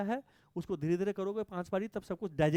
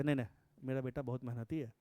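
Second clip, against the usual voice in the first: shy father; 7 semitones lower, 6 dB quieter than usual. Retold or imitated. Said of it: "कुछ बोलकर शर्मा जाते".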